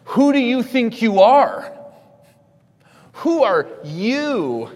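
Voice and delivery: high, loud outraged demand; indignant challenge. Acted. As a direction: throw it out slowly, punching "you". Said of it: "that statement is what elicited the response".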